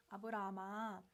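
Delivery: low-key, unhurried call to Abraham; calm call. Acted. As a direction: call the name calmly, without urgency. God first calls Abraham calmly. (차분하게 부르는 목소리)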